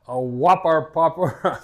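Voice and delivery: drawn-out Massachusetts accent, dropped r's; laughing Massachusetts demo. Shown as a demonstration. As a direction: show off your Boston-area accent, slowly. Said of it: "How would you say it?".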